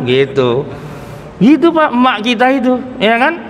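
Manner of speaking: usual speaking voice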